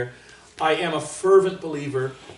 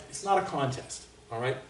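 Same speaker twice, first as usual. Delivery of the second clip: ordinary delivery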